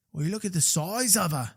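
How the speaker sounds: put-on Steve Irwin style Australian accent; lightly Aussie, like a wildlife host. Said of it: "If my Australian accent is way off".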